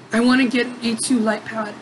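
ordinary delivery

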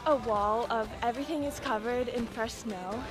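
ordinary talk, nothing marked

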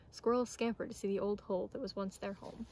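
ordinary delivery, nothing marked

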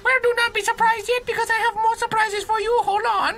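hammed-up Chinese-accented trainer; mock Chinese accent. Game dog promises surprises, hammed-up mock Chinese accent. Voices the game's dog character.

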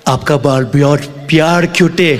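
slow, careful learner's Hindi; comic chatting up a woman in Hindi; clumsy Hindi. Retold or imitated. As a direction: lay on the badly learned Hindi. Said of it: "and was like".

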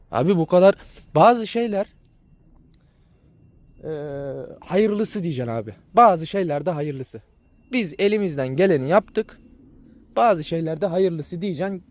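ordinary delivery

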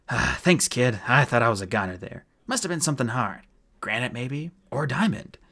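hearty, relieved character voice; relieved gruff dwarf. Acted. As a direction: sound like a dwarf just out of agony. Mulch speaks after relief.

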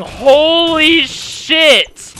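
high, slow, stretched-out hyped yell; excited shout. Real, not acted. Hyped fan hearing the song.

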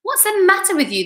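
put-on old grasshopper asking, voice pitched high. A narrator's reading. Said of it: "the old green grasshopper asked".

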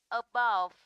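light a, then punch the second syllable of above; stress on second syllable. Shown as a demonstration. Teacher models a preposition, above stressed on its second syllable. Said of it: "rơi vào âm số".

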